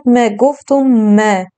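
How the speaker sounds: م said light and thin; light thin م sound; light mim. Shown as a demonstration. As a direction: keep the م in ما light, not full. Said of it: "میم ما از جملهٔ حروف سبک بود".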